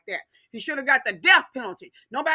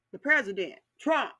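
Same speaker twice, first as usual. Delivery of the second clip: normal talking voice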